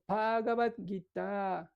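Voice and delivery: Bhagavad Gita slowly drawn out; lengthened Sanskrit vowels. Shown as a demonstration. Teacher giving an example of lengthening, Bhagavad Gita said long. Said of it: "se alargan algunas palabras".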